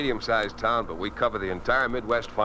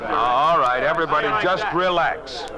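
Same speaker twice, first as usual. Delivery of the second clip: raised, commanding call over the room; loud and a touch higher, take charge of the room; 6 semitones higher, 6 dB louder than usual. Acted. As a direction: boss shouting the staff down to order. Editor calls noisy staff to order.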